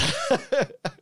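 real laugh breaking out; high burst of laughter. Real, not acted. Player eating nuts at table.

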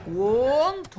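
"want" said high and loud; stressed want. Shown as a demonstration. Teacher stressing "want" in the full answer. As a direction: punch the word "want". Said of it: "جملة كاملة اللي هم they".

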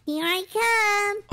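calling out. Real, not acted. Seeker calls out after counting.

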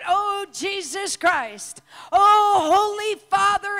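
a loud, slow, desperate call to heaven; crying out to God. Acted. Believer calling on God, desperate cry. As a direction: cry out, then break into a shout on oh.

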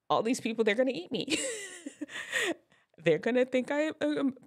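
high, mock-panicked worrying; hammed-up anxious inner voice; acted stage fright. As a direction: play a nervous performer fretting before the show. Acts out nervous performer's panicked thoughts.

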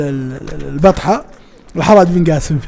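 ordinary delivery

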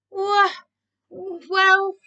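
halting, high false starts; stammering. A narrator's reading. Keith playing for time, stammering. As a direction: stall word by word, starting high and loud. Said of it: "he stammered".

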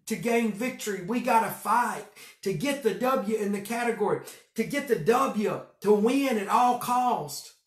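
preaching at full force. Acted. Pastor contrasting the world's way with God's, loud and rising. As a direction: drive it home to the church.

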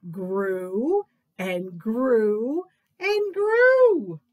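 slow growing emphasis. A narrator's reading. Reader to children, drawing out the growing fish slowly. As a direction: stretch each grew as it grows.

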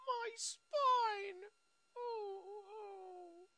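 high, faint pained whimper; acted agony. Injured fighter with broken spine, faint high whimpering. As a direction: trail off into tiny high moans of pain.

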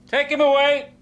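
high, loud command; shouted order. Acted. Doctor orders the patient taken away, shouted high and loud. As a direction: call out the order at full voice.

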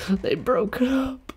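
hammed-up heartbreak breaking into a shriek; mock wail. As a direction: fake sorrow cracking into a howl. Mock despair at breakup news.

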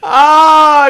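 high shrieking laugh into a loud ah. Real, not acted. Laughing loudly at the silly pet. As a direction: burst out laughing, then cry out.

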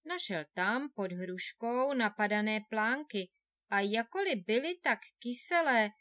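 ordinary delivery, nothing marked

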